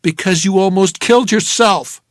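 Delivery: loud and sharp; angry frightened shout. Acted. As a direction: shout it at her. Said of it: "I shouted".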